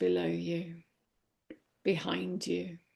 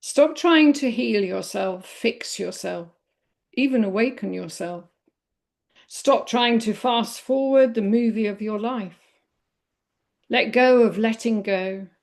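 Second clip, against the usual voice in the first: measured poem reading in short, separated phrases; 4 dB louder than usual. Real, not acted. Meditation teacher reading a poem on healing to listeners, poem reading. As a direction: let each phrase land, with lifts on key words. I'm going to read a poem.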